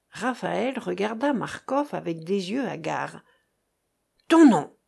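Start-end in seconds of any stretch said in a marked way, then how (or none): 4.3-4.8 short, loud, raised demand; crying out. A narrator's reading. The sailor demands the dying man's name, crying out. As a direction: fire it out as an order. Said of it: "s'écria le marin".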